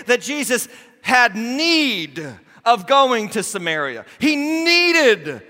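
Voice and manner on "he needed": ordinary talk, nothing marked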